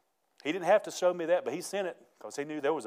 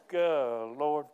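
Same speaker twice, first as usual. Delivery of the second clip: normal talking voice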